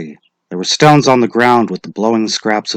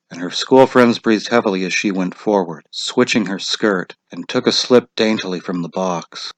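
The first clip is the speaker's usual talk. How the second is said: ordinary delivery, nothing marked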